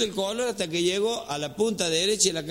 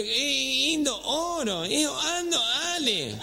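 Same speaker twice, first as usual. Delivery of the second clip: high nasal garble with dropped consonants; gangoso; 5 semitones higher than usual. Acted. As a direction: imitate someone talking through the nose, barely intelligible. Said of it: "medio gangoso era". (habla gangosa y aguda, comiéndose consonantes)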